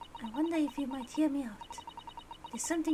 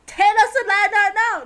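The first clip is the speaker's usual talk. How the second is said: loud demand again; 6 semitones higher, 12 dB louder than usual. Acted. Repeating demand.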